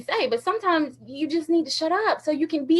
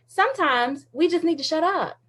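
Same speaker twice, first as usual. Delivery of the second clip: ordinary talk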